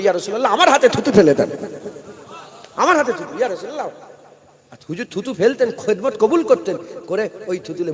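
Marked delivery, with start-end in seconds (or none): 0.0-3.9 loud, high pleading of the companions; eager companions pleading. Acted. Companions crowding the Prophet, eagerly pleading. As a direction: beg for the blessing, eager and crowding.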